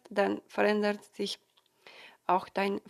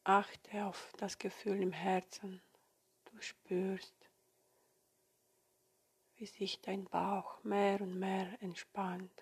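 soft spa whisper; 9 dB quieter than usual. Acted. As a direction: whisper them gently into calm. Leads a guided meditation.

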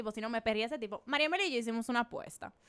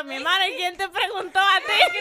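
mock snub; 8 semitones higher, 12 dB louder than usual. Retells snubbing a guy at party.

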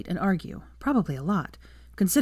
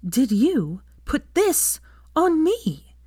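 very slow, much higher, every word spaced out; each word slowly articulated; 10 semitones higher, 4 dB louder, much slower than usual. Acted. Hermione brandishing her arm at Draco, slow articulated words. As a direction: bite off each word with menace. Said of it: "articulating each word slowly".

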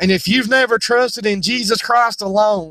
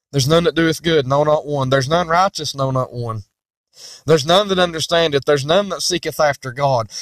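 lower, grave preaching; solemn scripture refrain; 5 semitones lower than usual. Acted. Preacher hammering the verdict home, grave. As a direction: let each line fall heavily. Repeats verses emphatically.

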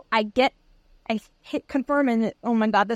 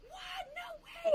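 much higher shriek of disbelief; freaking out; 17 dB quieter, much slower than usual. Retold or imitated. Neighbor Julie reacting to the news, high shriek. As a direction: shriek it up high like you can't believe it. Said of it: "she's, like, freaking out with me".

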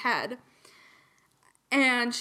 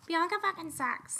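high, quiet mock whine; skit character voice; 7 semitones higher, 6 dB quieter than usual. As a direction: put on a small high voice for the skit. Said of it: "doing my little skit bit where she's like".

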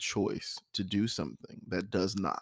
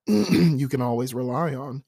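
hoarse throat, clearing it mid-sentence; frog in throat; 5 semitones higher, 7 dB louder than usual. Real, not acted. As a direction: talk through a frog in the throat. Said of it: "there's a frog in my throat".

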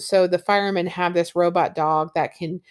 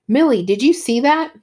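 startled question; 6 semitones higher, 5 dB louder than usual. Acted. Montag startled by the wall show.

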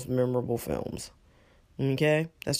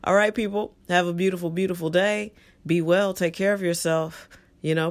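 normal talking voice

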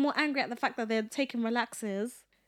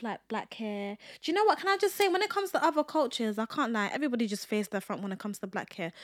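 ordinary talk, nothing marked

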